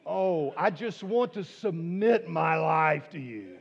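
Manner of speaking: loud, high mock swoon, slow; hammed-up swooning woman. Preacher mocking a woman who adores her man, loud and hammed-up. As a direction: overplay a woman's adoring gasp. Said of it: "get a woman to look at us and go".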